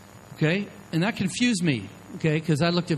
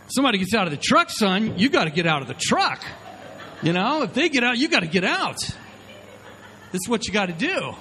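defensive excitement; 7 semitones higher than usual. Retold or imitated. Dad justifying himself to son.

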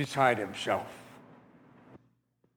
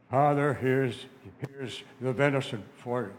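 put-on voice posing as the brother; Esau; 2 semitones lower than usual. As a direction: disguise your voice to fool your father. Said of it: "he didn't like my voice".

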